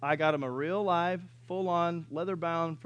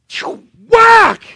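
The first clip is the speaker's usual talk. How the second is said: high shouted whip-crack noise; loud whip crack; 21 dB louder than usual. Retold or imitated. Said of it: "really loud".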